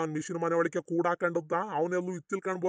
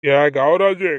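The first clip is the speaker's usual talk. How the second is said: loud, lower indignant challenge; heated, booming retort; angry warning; 4 semitones lower, 9 dB louder, much slower than usual. Acted. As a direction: fire back at an accuser. Accused man warns his accuser.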